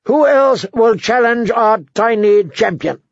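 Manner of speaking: high-pitched public challenge; crowing royal herald; taunting young king. A narrator's reading. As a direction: call out to the hall, high and mocking.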